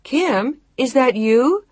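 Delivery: high, loud call. A narrator's reading. Woman calls out again from the back, high and loud. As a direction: call out the name, then ask. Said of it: "But then she called out again".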